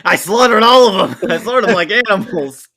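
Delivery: loud mock-dramatic outburst; hammed-up movie quote. Quoting Star Wars to co-hosts, hammed-up loud outburst. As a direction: belt it out like an overacted film confession.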